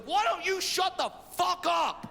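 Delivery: high, slow mock-angry comeback; angry retort. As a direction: imitate an irate driver, stretching the words. Rude driver snaps back at mom.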